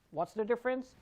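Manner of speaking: normal talking voice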